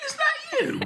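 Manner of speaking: very high, squealing question; shrill-free high excited squeal of a friend; mock excited caller. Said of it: "ringing you up going".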